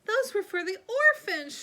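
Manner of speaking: very high, very loud shriek. A narrator's reading. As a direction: shriek in outrage. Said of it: "shrieked Aunt Figgy".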